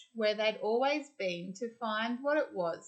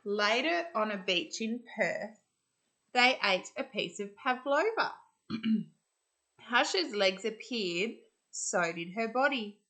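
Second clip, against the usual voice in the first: ordinary delivery, nothing marked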